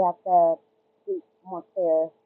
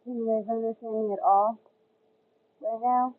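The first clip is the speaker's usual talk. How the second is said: muffled like underwater; bad earbud underwater sound. Real, not acted. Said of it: "Does it still sound like I'm underwater?".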